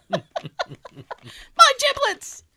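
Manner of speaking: giggling through the word; laughing. Real, not acted. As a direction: squeal it while laughing. Laughing exclaim.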